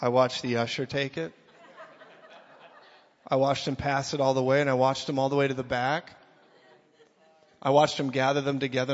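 normal talking voice

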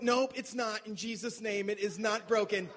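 loud, high, firm faith-declaring shout; mock prayer rebuke. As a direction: command the injury away like a preacher. Friend rebuking injury in prayer.

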